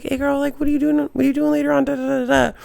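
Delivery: high, sing-song mock pickup line; hammed-up smooth-talker imitation; flirty guy impression. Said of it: "He was like".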